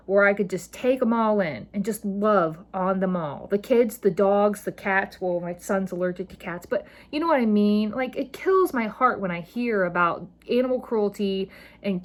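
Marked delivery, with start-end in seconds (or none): none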